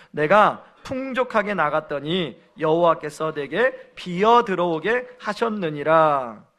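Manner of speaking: loud, solemn reading of scripture. Acted. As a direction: declaim the verse to the congregation. Congregation reads scripture together. (성경 봉독 낭독)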